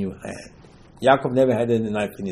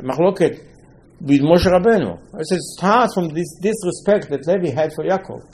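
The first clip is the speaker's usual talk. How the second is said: ordinary delivery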